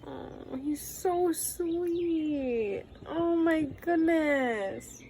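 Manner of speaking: high, slow adoring coo. Real, not acted. Gushing over the little pet.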